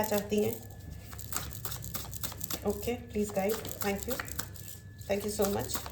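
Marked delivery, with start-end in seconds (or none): 2.7-5.8 softly murmured thanks to the guides; hushed aside. Real, not acted. Tarot reader privately asking her guides for guidance, hushed. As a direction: murmur it under your breath, much softer.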